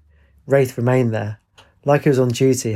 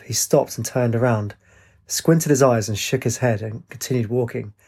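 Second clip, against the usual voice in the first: usual speaking voice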